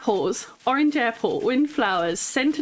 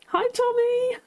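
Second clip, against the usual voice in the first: much higher sing-song hello; greeting the shopkeeper cheerfully; giddy greeting; slower than usual. Real, not acted. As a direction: chirp it out happily. Greeting a game character.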